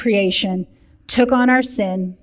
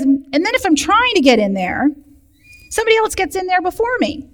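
lightly acted complaint, climbing higher at the end; sick man carrying on; 8 semitones higher than usual. Sick man explaining to Jesus why he stays, lightly acted carrying on. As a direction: make excuses as the sick man, pitch rising. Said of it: "He's carrying on".

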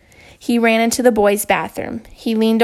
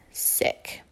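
whispered; 13 dB quieter than usual. A narrator's reading. Narrator ends chapter quietly.

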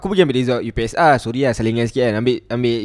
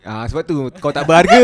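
usual speaking voice

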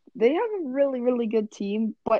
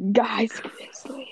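slow echoing whisper; ASMR whisper; slower than usual. Pretend. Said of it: "Are we doing the A S M R?".

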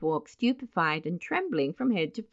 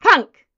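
high, loud spoken clunk; imitated clunk; 11 semitones higher, 13 dB louder than usual. Narrator sounding the basin hitting the floor, loud clunk. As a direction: punch out a falling-basin clunk.

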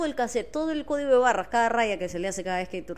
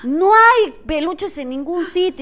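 louder, higher heated rant; 5 semitones higher, 7 dB louder than usual. Real, not acted. Host ranting to viewers about the crowded streets, fired up. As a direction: vent about the Valentine's chaos. Ranting against Valentine's outings.

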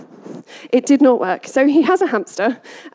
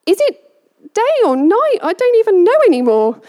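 higher, louder confused hamster voice; mock bewildered pet waking up; 7 semitones higher, 4 dB louder than usual. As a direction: play a baffled little hamster. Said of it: "She's, like, waking up going".

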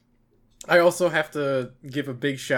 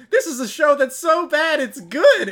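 high, loud, slow mock proclamation; mock Tiff; 11 semitones higher, 6 dB louder than usual. Tiff declaring the show so bad, slow high mock. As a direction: proclaim it grandly, slowly. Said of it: "Tiff is like".

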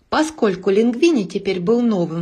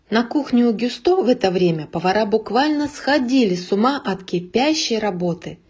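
ordinary delivery, nothing marked

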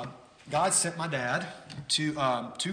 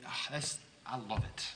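ordinary delivery

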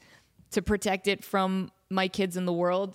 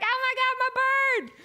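much higher, loud thrilled cry; delighted squeal; 4 dB louder, faster than usual. Retold or imitated. Jamie Lynn thrilled her bird dropped into the scene, high squeal.

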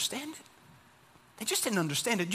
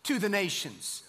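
louder, higher, slow solemn proclamation; scripture reading; 3 semitones higher, 4 dB louder, slower than usual. Acted. Preacher reading Isaiah to his church, a louder, higher, slow solemn proclamation. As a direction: proclaim the prophecy with weight.

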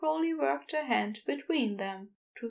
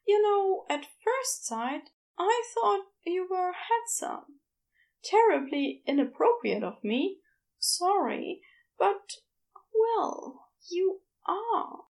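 higher, flustered Aziraphale admitting attraction; higher Aziraphale confessing he finds Crowley handsome; 7 semitones higher than usual. A narrator's reading. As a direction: lift the voice, shy and apologetic. Aziraphale flirts shyly.

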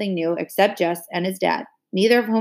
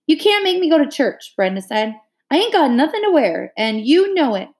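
high, whiny wail of protest; wailing complaint; 7 semitones higher, 3 dB louder than usual. A narrator's reading. Brenda wailing to Mama about church clothes. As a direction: whine it like a siren going off. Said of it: "A wail went up from Ellie and Brenda".

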